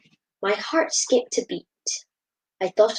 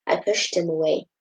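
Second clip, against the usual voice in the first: ordinary delivery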